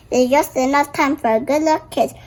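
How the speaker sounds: normal talking voice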